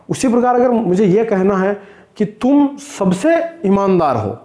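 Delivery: ordinary delivery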